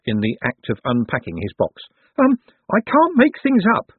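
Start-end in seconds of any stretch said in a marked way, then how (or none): 2.2-3.9 very high, anxious little man; helpless Owlswick. A narrator's reading. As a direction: confess it in a high, nervous voice. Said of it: "staring at a paintbrush as if".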